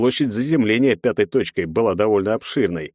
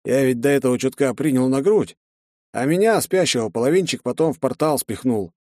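plain reading voice

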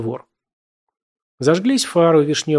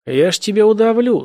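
loud, higher threat through a forced smirk; forced grin threat; 8 semitones higher, 5 dB louder than usual. A narrator's reading. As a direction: threaten while forcing a smile. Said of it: "сказал он, с усилием усмехнувшись".

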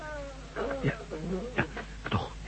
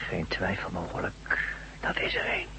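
tense unvoiced whisper; 5 semitones lower, 10 dB quieter, slower than usual. Man whispering to his friend over the wounded gangster.

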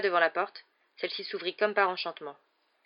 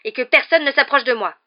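loud, higher, agitated defiance; respiration précipitée; 6 semitones higher, 12 dB louder than usual. A narrator's reading. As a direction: stand your ground, worked up with rage. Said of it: "dit-il, la respiration précipitée". (défi haletant et rageur)

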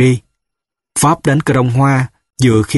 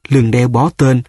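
usual speaking voice

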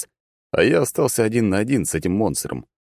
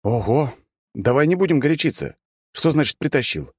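higher-pitched placating protest; calm them down in a raised pitch; trying to clarify; 7 semitones higher than usual. A narrator's reading. Hero trying to explain himself to angry dendroid, placating. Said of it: "попытался я внести немного ясности".

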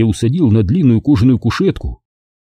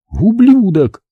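высокое злое слово, как удар; брось оскорбление высоко, словно пощёчину; ледяное бешенство; 11 semitones higher, 3 dB louder, slower than usual. A narrator's reading. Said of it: "в её голосе было столько ледяного бешенства". (ледяная ярость на высокой ноте)

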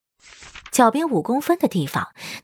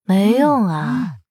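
slow, disdainful brush-off; much slower than usual. A narrator's reading. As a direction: sound fed up and dismissive. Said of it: "语气十分嫌弃".

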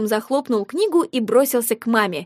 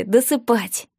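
plain reading voice

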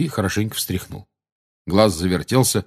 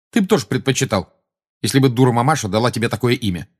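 ordinary delivery

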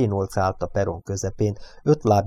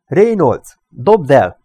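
raised, high command; shouting; 5 semitones higher, 8 dB louder, much slower than usual. A narrator's reading. Parker with pistol orders Reynolds to disarm, shouting. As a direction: shout a warning with force. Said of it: "kiáltotta".

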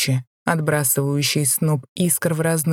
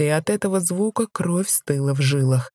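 ordinary delivery, nothing marked

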